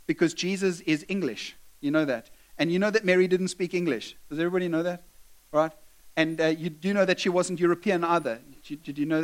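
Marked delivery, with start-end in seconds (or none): none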